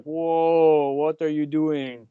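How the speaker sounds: put-on rough go at a Polish accent, drawn out; half-hearted Polish accent, slow mock astonishment; Robert. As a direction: stretch it out slowly in a loose Polish accent. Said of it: "I can't do his Polish accent".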